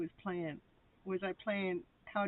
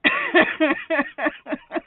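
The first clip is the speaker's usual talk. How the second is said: high, loud burst of giggling laughter; real laughter; 7 semitones higher, 12 dB louder than usual. Real, not acted. Amused at her frozen stream.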